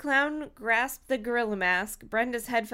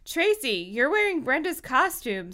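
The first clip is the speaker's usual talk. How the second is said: higher, louder startled yell; 5 semitones higher, 4 dB louder than usual. Acted. Someone in the crowd voiced to Tracy, yelled. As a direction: call it out across the crowd. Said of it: "someone yelled".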